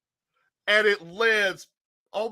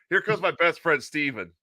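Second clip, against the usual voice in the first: normal talking voice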